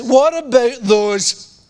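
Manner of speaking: slow, high sneering taunt; older brother mocking the little shepherd; mocking big brother. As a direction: drag it out to belittle him. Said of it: "That's how I imagine it being said".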